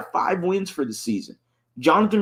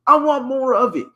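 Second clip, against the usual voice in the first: excited; 9 semitones higher, 5 dB louder than usual. Real, not acted. Hyped about the return.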